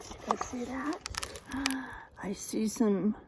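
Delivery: whispered discovery; hushed whisper. Acted. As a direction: whisper to make kids lean in. Teacher builds suspense over picture.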